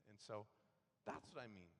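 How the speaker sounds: ordinary talk, nothing marked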